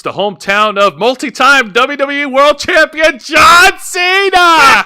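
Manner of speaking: building to a high, loud shout; hyped ring announcer. Pretend. Host introduces the hometown wrestling star, as a shouting hype announcer. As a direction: belt out the name like an arena announcer.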